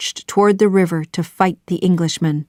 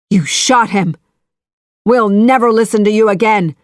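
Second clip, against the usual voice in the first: fast, louder outburst; shout; 3 semitones higher, 5 dB louder than usual. A narrator's reading. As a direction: rush it out in anger. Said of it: "shouted Thomas at Ratcliffe".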